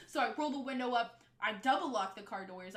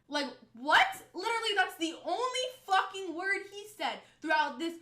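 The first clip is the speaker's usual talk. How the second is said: much louder, high-pitched disbelief; exasperated outburst; 8 semitones higher, 9 dB louder than usual. Real, not acted. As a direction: burst out in indignation at a useless friend. Exasperated at friend's one word.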